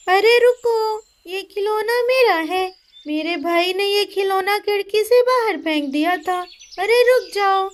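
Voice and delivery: put-on high girl's voice calling out. Pinki calling after Jaggu, high girlish call. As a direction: chase the boy who took the toy.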